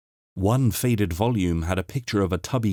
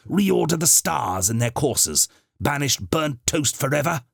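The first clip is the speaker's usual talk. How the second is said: mock grandiose; 6 semitones higher than usual. Doctor mocks his grand reputation.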